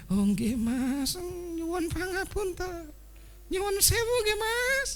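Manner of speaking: very high, slow, meek pleading; humble apologizing. Acted. Polite person apologizing humbly to the man, high and slow. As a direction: beg forgiveness sweetly and slowly.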